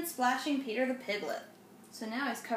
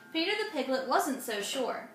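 usual speaking voice

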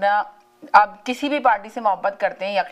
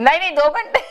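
amused protest; 8 semitones higher, 4 dB louder than usual. Real, not acted. Host corrects caller's joke.

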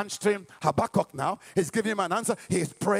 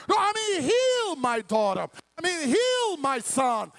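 acted insistence; 8 semitones higher than usual. Father corrects God's answer, insistent acted plea. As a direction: plead the correction, stretching the key verb.

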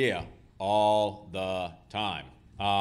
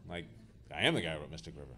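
ordinary delivery

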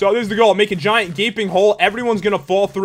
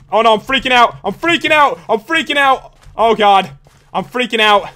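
high, loud, repeated panicky cries; freaking out; 3 semitones higher, 4 dB louder than usual. Real, not acted. Gamer down to last three players, freaking out. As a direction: let panic take over, pitch jumping up. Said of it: "The panicking. It's setting in".

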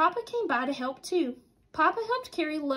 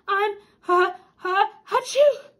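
acted sneeze; 6 semitones higher, 8 dB louder, slower than usual. Centipede sneezing.

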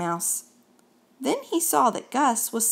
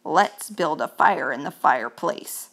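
chattering teeth; 6 semitones lower than usual. Acted. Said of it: "said Mouse with chattering teeth".

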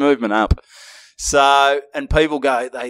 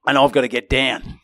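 ordinary talk, nothing marked

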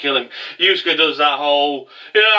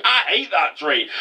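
ordinary delivery

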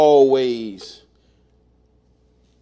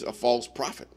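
quiet aside; 3 semitones lower, 11 dB quieter than usual. Real, not acted. Muttered aside about Hadad.

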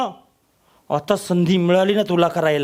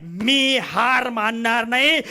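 slow vow; 4 semitones higher, slower than usual. Acted.

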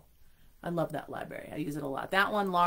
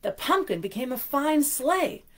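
storybook reading voice; story narration; 7 semitones higher, 5 dB louder than usual. A narrator's reading. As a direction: read it like a bedtime story. Reads picture book aloud.

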